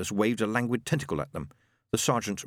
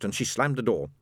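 ordinary delivery

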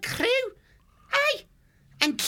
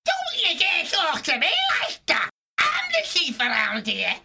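indignant villain boss shouting; threatening; 3 dB louder than usual. Acted. Chief threatening a weasel who answered back, angry. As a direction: shout it as an affronted little chief. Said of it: "waved his broken cutlass about threateningly".

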